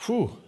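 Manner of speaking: high, loud whew; daunted whew. Retold or imitated. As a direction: let out a big whistly exhale of a whew. Reacts to the emailer's question.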